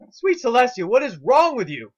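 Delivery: horrified outburst. A narrator's reading. Spike horrified.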